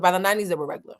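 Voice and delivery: fast, tossed-off repeat of the line; fast throwaway delivery; rushed punchline. Shown as a demonstration. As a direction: rattle it off quickly for the laugh. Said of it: "Okay? So they was rich as fuck".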